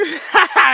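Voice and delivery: short high laugh; burst of laughter. Real, not acted. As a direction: laugh at your own joke. Laughs at own joke.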